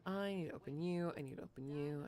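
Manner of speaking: ordinary talk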